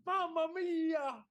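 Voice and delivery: put-on high, drawn-out Italian accent; mock Italian accent. [name] keeps up the Italian criminal act, drawn-out mock Italian accent. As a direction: stretch it out like a hammed-up Italian. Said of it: "the accent is something else".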